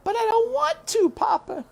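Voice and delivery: put-on high little girl protesting to her father; pleading little girl. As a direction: beg not to go.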